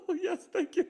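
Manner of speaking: high, laughing reply; laughing thanks. Retold or imitated. Patient thanking the doting nurse, high and laughing. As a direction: gush a laughing thank-you.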